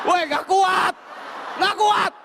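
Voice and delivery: high shouted protest. Retold or imitated. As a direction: yell that it can't hold. Car being pushed by motorbike.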